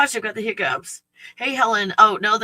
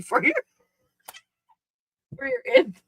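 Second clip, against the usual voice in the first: mock squeaky; faster than usual. Mocking high voice for dog.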